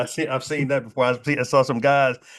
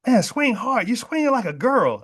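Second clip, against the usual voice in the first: high-pitched mocking heckle; hammed-up, high-voiced jeer; taunting golf pro; 9 semitones higher than usual. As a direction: imitate a pro goading a customer to swing. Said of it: "and he said".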